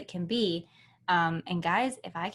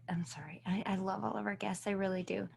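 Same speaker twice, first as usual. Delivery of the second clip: whispered aside; 9 dB quieter than usual. Real, not acted. Aside apology to guests.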